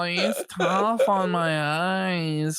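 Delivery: drawn-out, hammed-up whine about failing eyes; mock feeble complainer. Mocking Joe's failing eyesight, exaggerated feeble voice. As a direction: play a pitiful half-blind sufferer, slowly.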